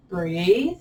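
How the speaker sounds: laughing through the word. Real, not acted. Teacher coaching a stretch while it burns, laughing. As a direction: let real giggles spill into the instruction. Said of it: "So I'm laughing".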